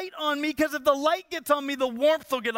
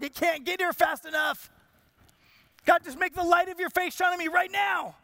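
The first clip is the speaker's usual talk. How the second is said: put-on higher-pitched pleading as a freezing child; re-enacted impatient plea; desperate impatience; 5 semitones higher than usual. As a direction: beg God urgently, acting out childhood desperation. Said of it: "just desperately sitting there going".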